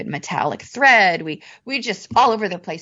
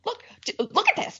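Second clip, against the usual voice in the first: urgent, breathless whisper, pitched way up; excited whisper; 4 dB quieter than usual. Retold or imitated. Grabbing passing guildmates at the exhibition, excited whisper. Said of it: "Calm down".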